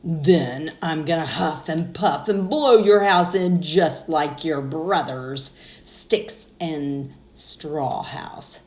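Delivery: slow, loud wolf threat; a hint of the big bad wolf huffing; lightly voiced wolf. Wolf threatens brick house.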